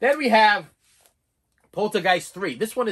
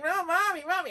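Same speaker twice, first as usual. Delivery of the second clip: put-on high child cry for mommy; mock little-girl whimper; scared little girl; 12 semitones higher than usual. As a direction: call out like a frightened kid. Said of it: "little girl's like".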